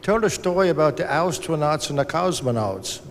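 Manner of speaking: put-on German-accented request, pitched slightly up. Retelling the rocket designer's request to his audience, German accent. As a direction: mimic a German rocket man with a thick accent. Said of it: "try my German accent".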